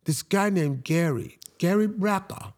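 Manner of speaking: attempted Australian accent. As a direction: imitate an Australian trainer, not very well. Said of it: "Is that your Australian accent?".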